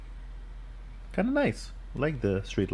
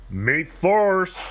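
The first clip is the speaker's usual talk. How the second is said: loud, slightly higher proud limo; puffed with pride; 5 semitones higher, 3 dB louder than usual. Acted. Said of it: "all puffed with pride".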